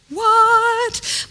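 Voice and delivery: put-on amazed toddler. Toddler amazed by theater lights.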